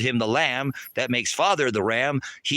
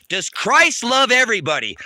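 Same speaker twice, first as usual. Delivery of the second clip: challenging question; 6 semitones higher, 5 dB louder than usual. Real, not acted. Rhetorical challenge to chat.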